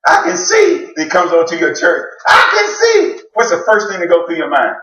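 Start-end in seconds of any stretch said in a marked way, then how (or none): 0.1-0.9 excited yell. Acted. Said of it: "yelling and screaming". 2.3-3.2 excited yell. Acted. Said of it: "yelling and screaming".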